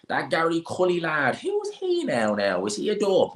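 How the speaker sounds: very high-pitched Dublin busybody; mocking Dublin accent. Clueless fight-week onlookers asking about a boxer, high mock Dublin accent. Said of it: "They'd be like".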